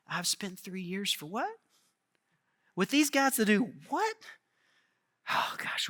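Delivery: soft weary exasperation trailing to a breath; Jesus sighing over his squabbling disciples; exasperated sigh. Retold or imitated. Tired, exasperated, soft. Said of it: "He's looking at his disciples and going".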